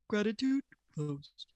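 slow announcer-style sound bite; tinny sound button clip. Shown as a demonstration. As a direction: say it like a recorded button. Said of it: "just do that again and I'll cut it out".

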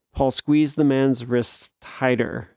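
plain reading voice

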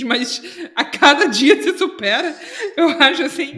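bitter laugh breaking into the line; real laughter at an absurd situation. Real, not acted. Laughs at how bad things are.

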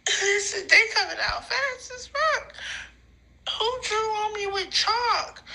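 high, giggly crying voice; tearful. Real, not acted. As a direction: whimper it high through tears and laughter. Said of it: "there's more tears".

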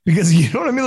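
ordinary delivery

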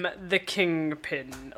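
low, slow, smooth as butter; deep Kingpin voice. Retold or imitated. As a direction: rumble it deep and slow like a crime boss. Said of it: "Can't even do a fucking Kingpin voice".

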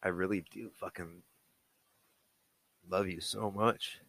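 real choked-up voice, long halting pauses. Real, not acted. Dad overcome while recording his child's birthday message, choked up. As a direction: struggle to get it out, softly. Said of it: "sorry, I'm getting choked up".

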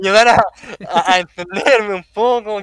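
loud, high, through laughter; laughing defensively. Real, not acted. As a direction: protest while cracking up. Defending himself while laughing.

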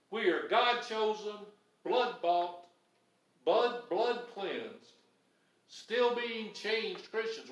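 slow, each epithet weighed; slow solemn litany. Real, not acted. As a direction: lay down each title slowly. Preacher's litany.

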